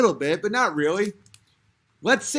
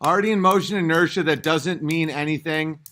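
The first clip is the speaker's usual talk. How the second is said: ordinary talk, nothing marked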